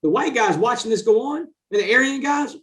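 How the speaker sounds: ordinary delivery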